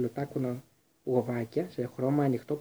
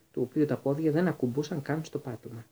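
ordinary delivery

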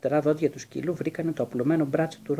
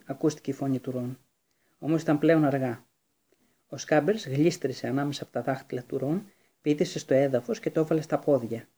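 ordinary delivery

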